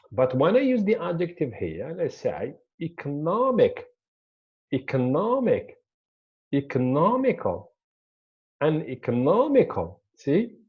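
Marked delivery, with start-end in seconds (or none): 2.8-10.0 "economic, economical" punched on NOM, then falling; shifted stress. Shown as a demonstration. Teacher showing how the adjective moves the stress, stress on NOM. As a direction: hit the third syllable, NOM, in "economic" and "economical". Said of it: "the stress here moved from this one".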